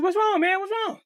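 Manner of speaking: put-on young boy asking his friend why he stopped; worried kid. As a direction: ask like a scared little kid. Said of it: "I said".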